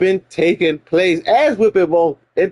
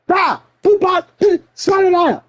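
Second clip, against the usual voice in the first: mama yelling; 11 semitones higher than usual. Retold or imitated. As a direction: yell at the kid like his mama. Mama yelling at son in story.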